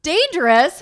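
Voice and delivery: loud, high mock-alarmed question; alarmed disbelief. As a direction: react like you can't believe that word. Acted alarmed reaction to captain.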